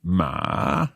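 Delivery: "Ma" echoed down then up; third tone. Shown as a demonstration. Learner copying the teacher, "Ma" falling then rising. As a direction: dip "Ma" and let it rise. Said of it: "third tone is going down and up".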